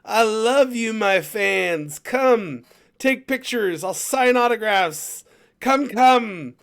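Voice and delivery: high, loud, showy star calling to fans; loud, high hammy showman voice; hammy Hugh Jackman. Retold or imitated. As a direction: ham it up like a star greeting his fans. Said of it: "he was like".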